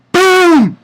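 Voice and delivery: high, drawn-out falling "boom"; explosive boom sound effect; mimicked blast. Reviewer imitating Sting's one hit, high booming burst.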